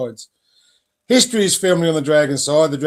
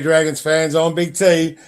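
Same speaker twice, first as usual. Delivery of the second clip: ordinary talk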